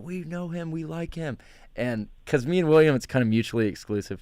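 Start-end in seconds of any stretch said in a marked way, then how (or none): 0.0-1.4 crowd cheering voice. Retold or imitated. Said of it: "They went".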